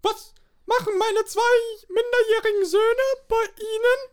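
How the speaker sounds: high put-on mother voice; a shrill-sounding comic mom. Mother voice in comedy sketch.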